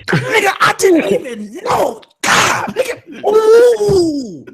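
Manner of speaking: high, straining cries of amazement; hammed-up awe. Hammed-up awe at two wise words.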